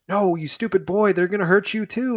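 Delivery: high, urgent scolding plea; frantic warning. Retold or imitated. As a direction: push the boy away out of fear for him. Dunk frantically warning Egg.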